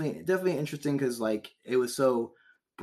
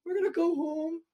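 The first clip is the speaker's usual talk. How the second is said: much higher timid voice; sheepish high voice. Retold or imitated. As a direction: sheepishly excuse yourself from the war. Voicing Bulgaria leaving the war.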